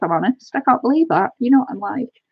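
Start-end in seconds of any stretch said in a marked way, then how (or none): none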